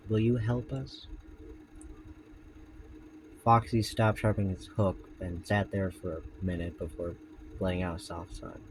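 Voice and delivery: low, soft story reading; story narration. A narrator's reading. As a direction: read the tale in a hushed voice. Reads Foxy story aloud.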